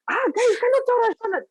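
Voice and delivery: put-on high, fast panicked kid stammer; lightly hammed-up frightened child voice; startled child. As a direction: imitate a spooked boy blurting out before fleeing. Scared child before everyone runs.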